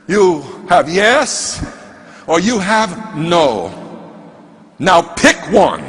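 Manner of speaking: imitated mentor, very slow and measured; deliberate ultimatum. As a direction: lay out the two choices slowly and firmly. Mentor lays out a stark choice.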